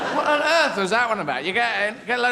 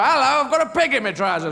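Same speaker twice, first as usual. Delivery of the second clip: announcing fool; 6 semitones higher, 5 dB louder than usual. Acted. Playing fool arriving with a pig.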